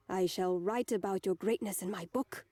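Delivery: lightly voiced bard with a German accent; a storyteller's pompous praise in a slight German accent; Osana voice. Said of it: "Steven says in his Osana voice".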